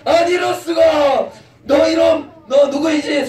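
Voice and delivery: hammed-up furious father for the kids; angry father. 화난 아버지가 아들을 꾸짖으며 높고 큰 목소리로. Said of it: "화가 났어".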